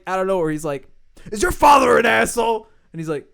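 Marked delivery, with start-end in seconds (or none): 1.3-2.7 high, loud mock interrogation; shouted demand. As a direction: imitate a host yelling a question out of nowhere. Acting out Taffer's sudden question.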